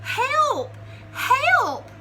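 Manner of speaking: high, loud cries for help; a little rabbit crying out in fright; frightened cry. Acted. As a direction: squeal it in panic. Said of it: "cried Rabbit".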